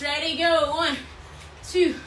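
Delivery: ordinary talk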